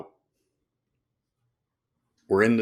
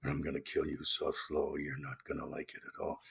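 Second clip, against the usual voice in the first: low, much softer mumbled menace; low mumbled threat; 11 semitones lower, 13 dB quieter than usual. Shown as a demonstration. A film villain's threat, mumbled low and soft. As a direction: growl the threat almost inaudibly. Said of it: "because everybody does that".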